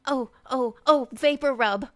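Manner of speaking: ordinary talk, nothing marked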